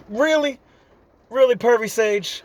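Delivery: calling out the con in disbelief; incredulous retort. Acted. Naruto to Jiraiya, incredulous and exasperated.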